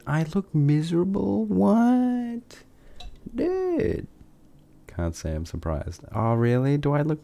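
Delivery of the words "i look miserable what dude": very high, squeaky put-on voice, mock offended. Answering chat's tease in a squeaky high voice. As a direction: pipe it up like a cartoon. Chat teasing him, squeaky reply.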